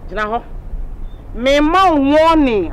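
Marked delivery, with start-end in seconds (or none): 1.3-2.7 drawn-out, louder warning; stern warning. Acted. Landlady warns Kachi before going to sleep, stern warning. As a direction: stretch it out as a raised warning. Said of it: "I'm giving you a warning".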